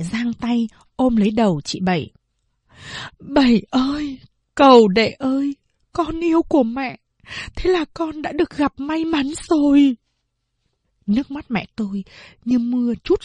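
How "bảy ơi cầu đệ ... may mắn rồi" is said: higher, tearful farewell to her daughter; a mother holding back tears, voice lifted. Acted. Mother hugs daughter she gives away, lightly tearful. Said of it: "Mẹ tôi bỗng ứa nước mắt".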